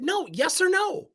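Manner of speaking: high, insistent demand; forceful. Retold or imitated. Manager pinning down an evasive employee, forceful. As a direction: press for an answer, slow and high. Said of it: "I did that very forcefully with her".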